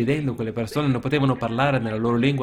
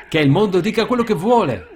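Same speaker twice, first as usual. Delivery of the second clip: much higher and louder, ringing with joy; triumphant declaration; 5 semitones higher, 5 dB louder than usual. Real, not acted. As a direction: proclaim it in defiant triumph. (il predicatore proclama la sua fede, trionfante e a gran voce)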